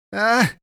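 loud, high gasped cry; startled gasp. A narrator's reading. Eddie meets the creature's giant eye, startled gasp. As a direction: cry out in sudden shock. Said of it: "he gasped".

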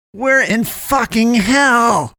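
high, drawn-out angry wail; angry outburst. A narrator's reading. Poe snaps at Damien in the underground city, angry. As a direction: stretch every word out high in anger.

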